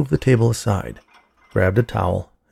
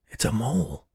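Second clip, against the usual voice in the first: hushed; 3 semitones higher, 7 dB quieter than usual. A narrator's reading. Hushed talk beside sleeping patient.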